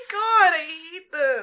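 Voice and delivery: slow, drawn-out groan of dread. Real, not acted. Friend dreading Oliver Twist, groaning slowly. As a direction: moan it out like you're doomed. Groaning about reading Dickens.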